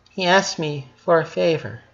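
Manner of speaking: high voiced sister reassuring; airy girl voice. A narrator's reading.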